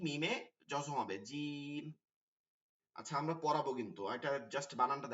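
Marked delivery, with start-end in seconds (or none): 1.3-1.8 "জিম" with a long held ee before the m; stretched vowel. Shown as a demonstration. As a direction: draw out the middle of "জিম". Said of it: "এক আলিফ করে টেনে টেনে". (এক আলিফ টেনে "জিম")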